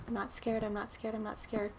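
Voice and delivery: soft, repeated under her breath to calm her nerves; talking herself through the fear, quietly; nervous self-reassurance. Real, not acted.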